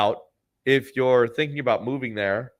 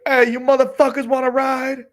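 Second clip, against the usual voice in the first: loud, high-pitched hawker's holler; rowdy sustained high yell; yelled greeting; 14 semitones higher, 6 dB louder than usual. Retold or imitated. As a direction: holler it out to people on the street. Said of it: "I yell".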